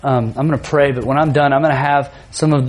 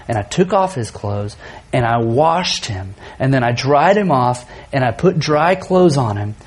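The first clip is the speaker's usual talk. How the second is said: tender retelling; 4 semitones lower than usual. Real, not acted. Father tenderly recalling washing his hurt son.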